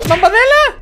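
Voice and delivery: high, loud call across the room. Real, not acted. Player calling out to Bambadela, loud high call.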